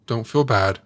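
normal talking voice

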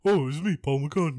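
[name] impression. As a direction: announce yourself as an old Beatle, up and bright. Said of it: "you do your [name]".

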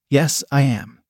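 ordinary delivery

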